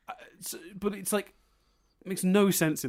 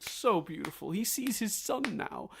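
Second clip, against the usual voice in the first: mock gushing audience; 6 semitones higher than usual. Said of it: "and go".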